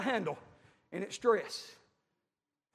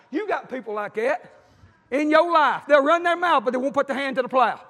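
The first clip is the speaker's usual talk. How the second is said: fiery preaching; 3 semitones higher, 8 dB louder than usual. Real, not acted. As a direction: drive it home to the congregation. Preaching at full force.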